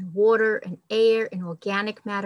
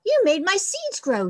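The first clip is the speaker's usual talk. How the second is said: happy mouse; 10 semitones higher, 3 dB louder than usual. Acted. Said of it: "said the mouse".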